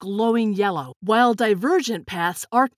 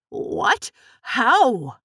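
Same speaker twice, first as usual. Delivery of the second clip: startled, disbelieving questions; shocked; 3 semitones higher, 3 dB louder than usual. A narrator's reading. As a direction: blurt it out in shock. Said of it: "Grandpa was shocked".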